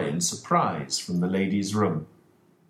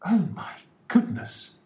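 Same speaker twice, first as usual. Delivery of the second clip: much higher shocked exclamation; exclaimed; 12 semitones higher than usual. Acted. Ferguson reacting to Holmes's revelation, much higher exclamation. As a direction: gasp it out in shock. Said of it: "exclaimed Mister Ferguson".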